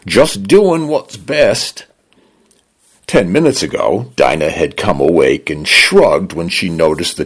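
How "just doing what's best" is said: slow, soothing British Cockney-tinged line; a hint of a Cockney home assuring her; Cockney-leaning British. A narrator's reading. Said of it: "male British voice that leaned toward a Cockney accent".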